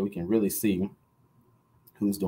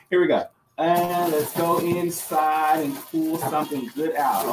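slow, higher, stretched words while rummaging; string it out as you dig for a prize; teasing slow build to the pull; drawn-out suspense; 5 semitones higher, slower than usual. Real, not acted. Drawing out suspense while digging.

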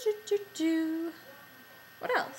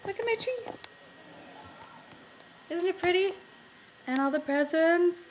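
high cutesy voice; 7 semitones higher, 3 dB louder than usual. Pretend. Showing off her Christmas tree.

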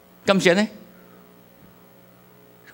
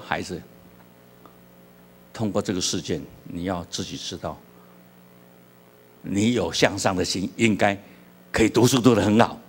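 gentle counsel; 8 semitones lower than usual. Acted. Pastor gently counsels the cheating child.

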